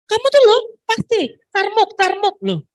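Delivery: put-on mother nagging her clingy child, high and loud; mock nagging scold. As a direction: nag the kid in front of guests. Parent scolding an attention-seeking child.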